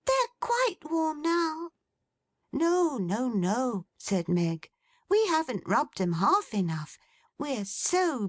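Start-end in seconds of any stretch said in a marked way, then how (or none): 0.1-1.7 put-on very high small child's voice; a little girl reassuring. 2.5-3.9 put-on much higher, light young woman's voice; Meg, young woman.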